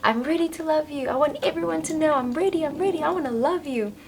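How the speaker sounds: pleading high voice, rushing the words; channeled message voice. Retold or imitated. Reading the channeled message aloud in a high eager voice. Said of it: "your channeled message literally says".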